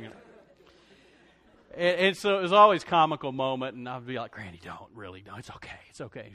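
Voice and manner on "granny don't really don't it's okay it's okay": soft, low pleading aside to granny; hushed plea. Retold or imitated. Hushed plea to granny not to make a fuss. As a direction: quietly beg granny not to start.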